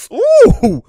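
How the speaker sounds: a sudden high, loud ooh; loud surprised ooh. Real, not acted. Reacts to the crossover.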